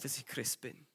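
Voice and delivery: normal talking voice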